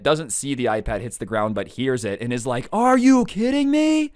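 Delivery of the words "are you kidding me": much higher, louder and slowed, incredulous yell; outraged disbelief. Retold or imitated. Cousin yelling up after hearing his iPad smashed, outraged. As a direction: bellow it like you can't believe what you just heard.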